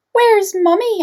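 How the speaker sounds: high, loud little owlet cry; worried baby owl. Acted. Baby owl Sarah missing her mother, high and anxious. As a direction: call out for mummy like a lost chick.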